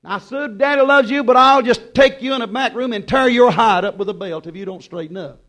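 put-on loud, stern fatherly warning to a child. Preacher voicing a father scolding his daughter Sue, loud and stern. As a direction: lay down the law to little Sue. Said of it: "and I'll say".

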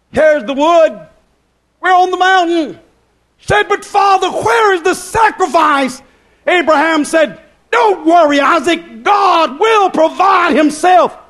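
high, shouted scriptural dialogue; full-force preaching. Acted. Preaches Isaac and Abraham dialogue.

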